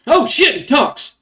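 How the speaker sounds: sudden high, loud yelp of shock; startled. Acted. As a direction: jump back at a talking pet. Rat folk startled Adam speaks.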